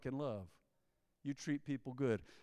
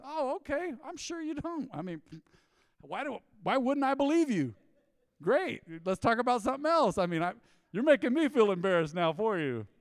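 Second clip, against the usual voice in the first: high, louder, overly polite pretend kindness; sarcastically sweet, pitched up and loud; mock gracious; 8 semitones higher, 6 dB louder than usual. Mock gracious reply to drinkers.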